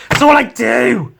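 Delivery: loud, high, fed-up outburst; exasperated shout. Real, not acted. Artist ranting about Inktober, shouting in exasperation. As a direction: throw it out in sheer exasperation.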